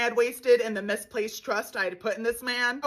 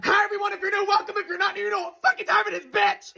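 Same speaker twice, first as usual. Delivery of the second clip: hyped intro; 8 semitones higher, faster than usual. Real, not acted. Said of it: "It's time for the Daily Scream".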